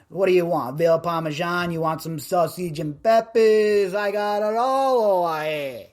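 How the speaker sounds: a hint of New York Italian; Italian-American cook. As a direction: offer the food like a Jersey Italian uncle. Italian cook offering food.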